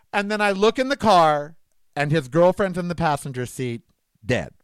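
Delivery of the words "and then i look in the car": ordinary talk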